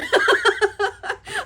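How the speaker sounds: high rising laugh; burst of laughter. Real, not acted. She laughs at Scrooge's reply, real high laugh. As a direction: crack up laughing.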